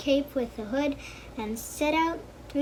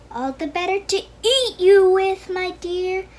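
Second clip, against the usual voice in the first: wicked wolf howling, much higher and louder; howled; 5 semitones higher, 8 dB louder than usual. Acted. Wolf springing on the girl, howling loud. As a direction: roar the threat out in full. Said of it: "howled the wicked wolf".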